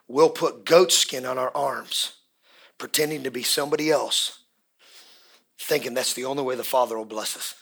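normal talking voice

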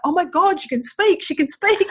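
very high-pitched mock exclamation; astonished joy. Doctor reacting to his patient speaking, astonished joy. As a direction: imitate a doctor squealing in amazement.

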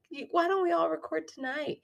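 high, sweet little plea; sweet pleading. Retold or imitated. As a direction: ask like a begging kid. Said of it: "three pairs of eyes going".

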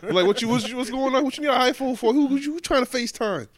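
high, loud, incredulous rant; loud mock outrage. Son loudly ribbing his mom for wanting an iPhone. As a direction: rant at her in mock disbelief.

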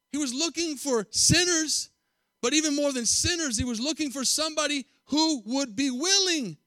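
high, loud preacher's rise; fervent preaching. Acted. Preacher rousing listeners with rising fervor. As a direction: build it up for the congregation. Building sermon passage.

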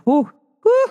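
two high-pitched whews; emphatic whew. Real, not acted. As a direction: exhale a high "whew" at his temper. Exclaims over her son's temper.

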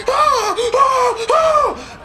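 high, loud burning screams; screams of pain. Acted. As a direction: scream as if burned. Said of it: "they start screaming in pain".

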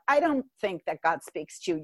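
normal talking voice

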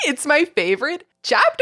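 put-on high, excited child voice; little kid voice. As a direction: squeal it like a small kid. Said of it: "so I'm doing this little kid voice".

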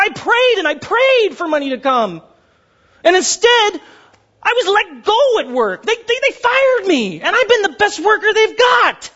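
high, pleading complaint; anguished protest. Acted. Struggling believer crying out to God, anguished protest. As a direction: plead upward in mounting frustration.

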